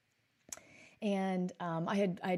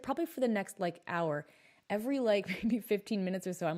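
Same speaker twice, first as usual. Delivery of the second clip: usual speaking voice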